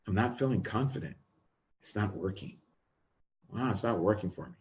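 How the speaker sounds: low, soft, defeated self-talk; deflated inner voice. Shown as a demonstration. Coach showing a defeated inner voice, soft and low. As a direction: mumble it like you've given up. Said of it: "My internal voice did not complement what I was doing".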